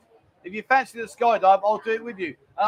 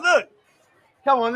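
ordinary delivery

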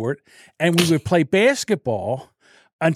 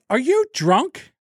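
dad's question; 7 semitones higher, much slower than usual. Retold or imitated. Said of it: "And he goes".

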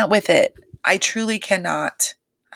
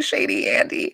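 breathy aside. Retold or imitated.

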